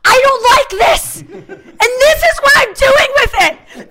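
imitating a furious, high-pitched outburst; Elaine. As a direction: shriek it out word by word, very high. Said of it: "she goes".

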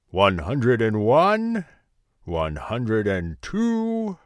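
slow, loud, drawn-out counting. A narrator's reading. Giant counting his gold, slow and drowsy. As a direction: count the coins slowly like a sleepy giant. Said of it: "he counted".

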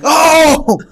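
sudden high, loud yelp of shock; retold excited yell. Replaying his outburst on the plane, high loud yell. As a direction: burst out like he did on the plane. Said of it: "I'm on FaceTime going nuts".

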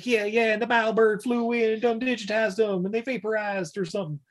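high put-on kid voice; accusing kid. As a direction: blurt the accusation as a riled kid. Retold kid accuses captain.

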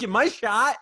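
high, startled question, half joking; alarmed. Retold or imitated. Retold panicked question.

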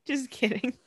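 normal talking voice

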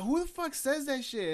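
high, slow mock disbelief; drawn-out, high retold indignation; incredulous girlfriend. Girlfriend's disbelief acted out again.

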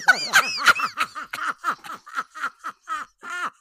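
high cackling witch laugh; evil witch laugh. Real, not acted. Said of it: "laughing like an evil witch".

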